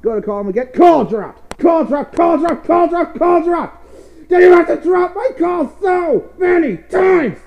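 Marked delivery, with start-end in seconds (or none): 0.7-4.3 loud, climbing higher with each repeat; rapid-fire furious repeats, building to a high yell; comic meltdown, louder and higher; escalating rage. Acted. Mounting rage at dropped calls. 4.3-7.4 high, loud screamed complaint; hammed-up rage, every word punched; furious shouting. Yelling at Skype.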